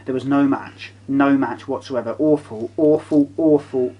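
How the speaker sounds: ordinary talk, nothing marked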